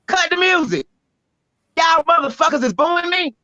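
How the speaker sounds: sharp, high retort to the booing crowd; calling out the crowd. Retold or imitated. Poet stopping the music to confront booers, sharp retort. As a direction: cut them off and call them out. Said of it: "And so I was like".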